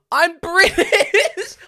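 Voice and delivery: high, very loud, stretched-out outraged cry; exasperated shout. Retold or imitated. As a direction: scream it slowly, cracking into laughter. Exasperated repeat of same answer.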